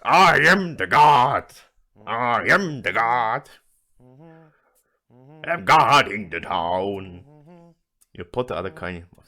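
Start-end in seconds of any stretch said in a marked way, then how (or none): 0.0-7.2 slow, very loud drawn-out bellow; full-volume crazed proclamation; screaming louder. Acted. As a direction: scream each word, stretching it out. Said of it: "and scream louder".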